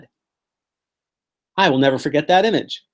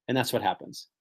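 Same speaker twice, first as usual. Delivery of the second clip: ordinary talk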